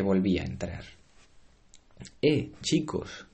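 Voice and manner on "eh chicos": slow higher hail; calling out. Acted. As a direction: call out across the kitchen. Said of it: "gritó".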